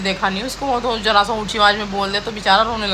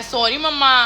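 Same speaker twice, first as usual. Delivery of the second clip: normal talking voice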